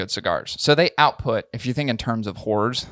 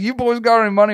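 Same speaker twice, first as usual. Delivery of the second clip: high-pitched put-on panhandler; imitating a street guy asking; imagined homeless man; 10 semitones higher, 4 dB louder, faster than usual. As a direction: beg from the boys in a comic voice. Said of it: "I imagine that's what he sounded like".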